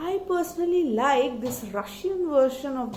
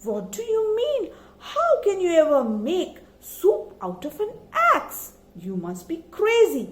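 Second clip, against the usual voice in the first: surprised; 6 semitones higher than usual. A narrator's reading. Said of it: "old lady was all the more surprised".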